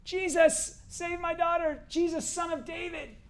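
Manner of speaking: high-pitched mock scream, not full volume; imitated desperate scream. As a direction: cry out like a desperate woman calling for help. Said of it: "this lady starts screaming".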